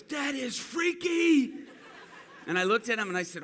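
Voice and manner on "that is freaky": slow, high, loud amazed cry; freaked-out shout. Retold or imitated. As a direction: draw it out, totally spooked. Guy stunned his leg grew.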